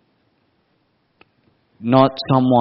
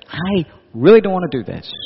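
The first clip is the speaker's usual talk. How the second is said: frustrated; 7 semitones higher than usual. Acted. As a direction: let the frustration push it up.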